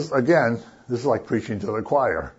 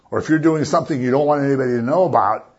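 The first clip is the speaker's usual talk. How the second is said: ordinary talk, nothing marked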